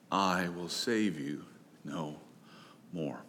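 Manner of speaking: low, slow and hushed pronouncement; God's grave verdict, each word set apart, dropping away; chilling. Acted. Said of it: "these chilling, nightmarish words".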